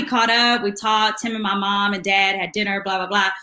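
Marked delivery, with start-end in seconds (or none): none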